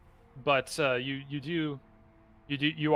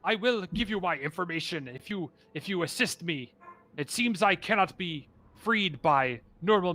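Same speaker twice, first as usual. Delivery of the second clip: higher, earnest voice of a trapped beast; hulking creature; 3 semitones higher than usual. Acted. Trapped creature bargains with adventurers, higher and pleading. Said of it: "hulking, almost gorilla-like figure".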